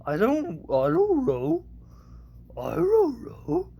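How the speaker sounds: high cartoon dog voice; Scooby. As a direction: answer like a baffled talking dog. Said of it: "that was Shaggy and Scooby".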